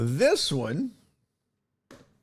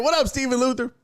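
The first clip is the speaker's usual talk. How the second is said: usual speaking voice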